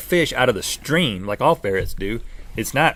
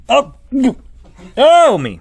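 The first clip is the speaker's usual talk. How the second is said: startled; 11 semitones higher, 7 dB louder, slower than usual. Real, not acted. Recoils at the gross food.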